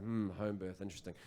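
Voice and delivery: low, quiet musing; mock pondering. Dad asked by friends for advice, low pondering murmur. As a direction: feign polite interest, soft and low.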